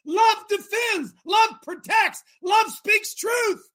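high, slow, drawn-out sermon shout; full-force preaching. Real, not acted. Preacher proclaiming what love does, shouting slowly. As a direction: cry out each phrase as a proclamation.

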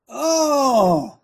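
high, drawn-out oh of feigned discovery; mock realization. Host reacting to celebrating Pride this month, mock realization. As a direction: act as if it just dawned on you.

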